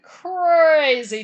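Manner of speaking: CRAZY stretched long and loud; drawn-out emphasis. Real, not acted. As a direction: lean hard and slow on crazy. Hyping how wild biker week was.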